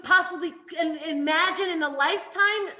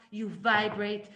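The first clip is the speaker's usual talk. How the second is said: ordinary talk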